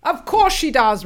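loud, high bark. Acted. Father barking at his daughter, loud. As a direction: snap it out loud. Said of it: "Roland barked".

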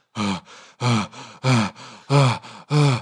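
loud, heaving gasps for air; acted heavy panting. Rider collapsed on the floor after the chase, panting. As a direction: pant hard as if just collapsed.

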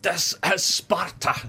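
high, drawn-out mock battle cry; hammed-up film warrior roar; Gerard Butler. Co-host launching into the film line, mock war cry. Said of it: "if Gerard Butler's in the crowd".

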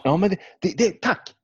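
jumps way up in pitch, bursting with delight; high excited yelp of agreement; excited outburst. Real, not acted. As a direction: blurt out thanks, thrilled. Thrilled someone brings up the game.

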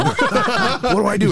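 high laughing question. Real, not acted. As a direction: laugh through it. Laughing while asking.

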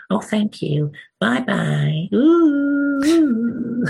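very high, squeaky beaver farewell; beaver puppet voice. Acted. Beaver puppet ending the audition sketch, squeaky beaver voice. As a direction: pipe a long puppet-like goodbye. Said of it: "doing my dreadful American accent".

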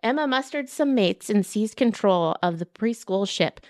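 usual speaking voice

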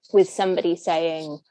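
normal talking voice

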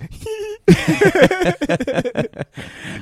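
loud high-pitched laugh; high burst of laughter. Real, not acted. Laughing at the idea of Nicky.

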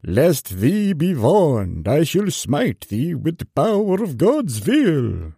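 slow, high, booming prophet voice; mock biblical prophet. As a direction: preach it like an ancient prophet. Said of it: "made him sound like an ancient prophet".

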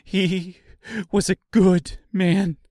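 high, distressed protest; crying out. A narrator's reading. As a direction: cry it out in his defence. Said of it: "cried the seamstress".

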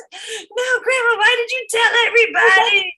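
high, loud crying wail; wailing girl. Retold or imitated. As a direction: wail it through tears.

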